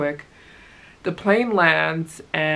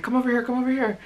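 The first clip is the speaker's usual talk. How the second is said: mild, high-pitched mock yell; retold yelling; 8 semitones higher, much faster than usual. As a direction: imitate men calling someone over. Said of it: "these guys start yelling at me".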